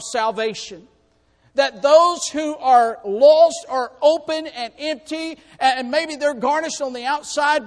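loud, high, urgent preaching; fiery preaching. Acted. Preacher urging the lost not to delay, shouting. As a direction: drive it home from the pulpit.

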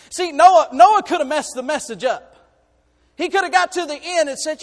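loud, high, building sermon voice; full-force preaching. Acted. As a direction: preach it out to the whole church.

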